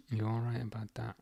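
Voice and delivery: muttered echo of his own words, under the breath; hushed aside. Real, not acted. Streamer agreeing with chat, repeating it quietly under his breath.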